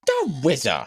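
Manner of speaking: high, slow lisping puppet; Tiny Chef. Retold or imitated. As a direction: present a guest in a babyish lisp. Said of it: "And I hear she goes".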